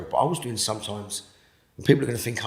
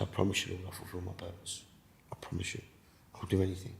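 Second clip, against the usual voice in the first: much softer, breathy whispered promise; hushed vow; 3 semitones lower, 12 dB quieter than usual. Retold or imitated. To the Lord, promising to fulfil his purpose, whispered. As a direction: whisper the vow with total devotion.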